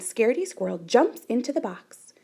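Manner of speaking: ordinary delivery, nothing marked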